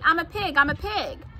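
put-on high-pitched boy clowning about; playful yell. A narrator's reading. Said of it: "yelled Avery".

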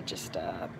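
breathy aside whisper. Real, not acted. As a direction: drop to a whisper. Aside about screaming friends.

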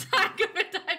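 gasping thanks between laughs; laughing thanks. Real, not acted.

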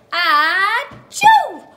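big, high, loud hammed-up sneeze; the cow's huge sneeze, played for the kids; mock sneeze. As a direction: blast out a pretend sneeze. Cow sneezes in story.

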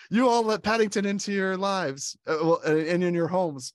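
high, loud mock scolding; accusing police guard. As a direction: berate the whole neighborhood, acting it out. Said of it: "the police guard".

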